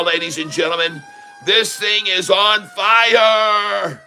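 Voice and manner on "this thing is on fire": drawn-out, raised cry of excitement; slow, high, thrilled announcement; hype shout. Real, not acted. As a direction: stretch it out like a hype man. Hyping a soaring stock live.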